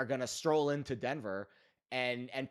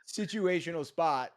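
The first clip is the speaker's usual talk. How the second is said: loud, high, emphatic insistence; worked-up, high-pitched rant; incredulous outburst; 6 semitones higher, 9 dB louder than usual. Real, not acted. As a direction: hammer it home at raised volume. Outraged insistence at unheard-of schedule.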